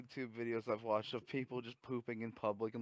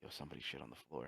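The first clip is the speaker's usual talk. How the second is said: hushed secret; 8 semitones lower, 12 dB quieter, faster than usual. Retold or imitated. Telling the employee secretly.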